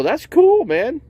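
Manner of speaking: thrilled to meet a Brady; excited fan. Retold or imitated. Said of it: "I go".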